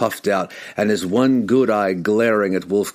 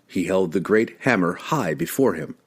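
ordinary delivery

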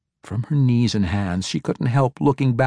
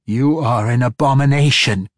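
louder, spat-out accusation; angry retort; 3 semitones higher, 6 dB louder than usual. A narrator's reading. Matron mother condemns the girl, loud angry retort. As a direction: condemn her with contempt. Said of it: "Quenthel retorted".

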